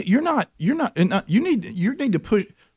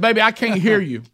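laughing; 7 semitones higher than usual. Retold or imitated. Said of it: "I said".

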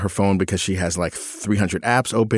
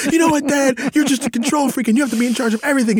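put-on much higher, whining teenage daughter; whiny teen outburst; 3 dB louder, much faster than usual. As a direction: throw a bratty teen tantrum at dad. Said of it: "Georgia would say something like".

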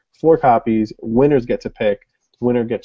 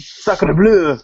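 put-on French accent; 7 semitones higher, 3 dB louder than usual. French exclamation, mock accent.

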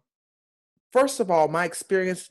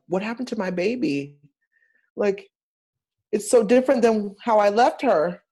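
dismayed; 5 semitones higher than usual. Retold or imitated. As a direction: fret over the show like a worried parent. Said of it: "I was like".

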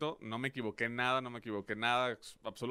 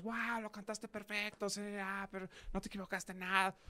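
mock amazed praise; 6 semitones higher, 9 dB quieter than usual. Everyone praising his anthem.

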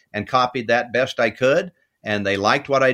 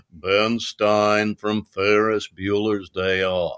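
slow, droll monotone; deadpan droll delivery, lightly done; droll Ben Stein; 3 semitones lower, slower than usual. Retold or imitated. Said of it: "But he's very droll".

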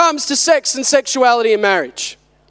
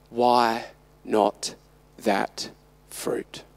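low, hushed, spaced-out tempting whisper; whispered temptation; 9 dB quieter, slower than usual. Acted. The liar tempting in the garden, whispered. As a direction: whisper it softly, pausing between phrases. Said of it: "who whispered".

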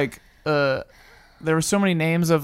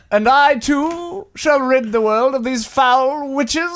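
righteous witch-hunter; 4 semitones higher than usual. Acted. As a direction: declaim a solemn vow with rising flourishes. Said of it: "do you have a voice and everything".

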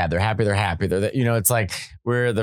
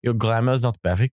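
put-on French ex-boyfriend; 3 semitones higher than usual. French guy refusing to let her speak French, in a French accent. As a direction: put on a French boyfriend correcting your grammar. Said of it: "as French people are".

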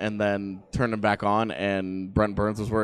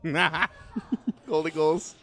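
higher, slow words broken by laughter; laughing repeat; 7 semitones higher, much slower than usual. Real, not acted. Co-host tickled by the rule's silly name, laughing. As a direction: laugh your way through the phrase.

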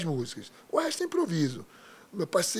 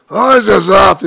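imitação do pai, bem alta e estourada; grito do pai imitado; 3 semitones higher, 17 dB louder, much slower than usual. Retold or imitated. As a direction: solte um berro de pai chamando no palco. Imita o pai chamando no palco. (grito imitando o pai)

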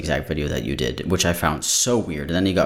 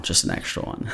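whispered aside; 5 dB quieter than usual. Real, not acted. Aside after announcing extra story.

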